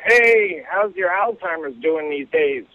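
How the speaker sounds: a hint of a cheery old guy on a porch; old friend teasing on the porch, lightly voiced old man. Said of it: "one of them says to the other".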